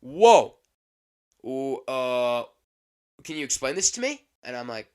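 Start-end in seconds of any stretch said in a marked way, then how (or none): 0.0-0.6 very loud, high cry; mock outraged snap. Imitating old customer seeing the price, loud outraged cry. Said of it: "he snaps instantly". 1.4-4.3 retold indignation. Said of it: "he snaps instantly".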